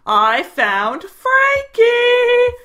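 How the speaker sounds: drawn-out high squeal of joy; hyper excited. Real, not acted. Showing viewers she found her pet Frankie, high excited squeal. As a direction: stretch it out, thrilled to find him. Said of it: "I'm a little bit hyper".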